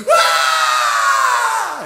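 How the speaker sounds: loud high-pitched wail; exaggerated demo of a woman crying; woman's wail. Shown as a demonstration. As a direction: burst out bawling, high and loud. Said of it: "Tapi kalau tangisan wanita".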